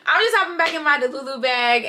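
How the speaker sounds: laughing through the words; real laughter over the line. Real, not acted. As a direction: giggle while you say it. Laughing at her own delusion.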